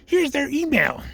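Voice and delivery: high-pitched mock receptionist; hammed-up gatekeeper; obstructive gatekeeper. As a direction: brush the caller off in a put-on high voice. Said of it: "the gatekeeper shoots you down like that".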